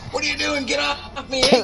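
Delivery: high, alarmed protest; high, flustered get off me; mock startled Hank. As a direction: push him off in a high panic. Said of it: "Hank's like".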